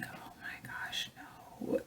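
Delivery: breathless whisper, slow on oh my gosh; whispering to herself as the child screams, dismayed. Retold or imitated. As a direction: hush it out under your breath in dismay. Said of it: "and I'm like".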